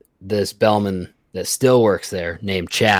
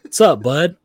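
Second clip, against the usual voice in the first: much higher, louder cheery greeting with a laugh; clueless Chad greeting; 9 semitones higher, 6 dB louder, slower than usual. Retold or imitated. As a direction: sing out a clueless hello. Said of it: "And he goes".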